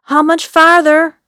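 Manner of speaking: loud, high and anxious; worried yell. Acted. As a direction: call out with fear creeping in. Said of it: "I couldn't keep the concern".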